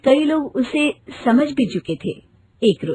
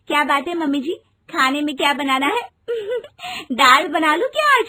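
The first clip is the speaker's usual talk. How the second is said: chirpy giggling questions to mother-in-law; happy bride giggling while asking about dinner; cheerful laughing; faster than usual. Acted. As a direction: laugh through the words brightly. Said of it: "Rinki की आदत खुश रहने की थी".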